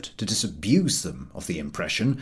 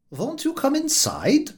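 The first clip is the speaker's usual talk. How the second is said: higher, slow, kindly offer; kindly stout gentleman. Acted. A stout gentleman invites the soaked walkers in, high and slow. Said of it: "asked the stout gentleman".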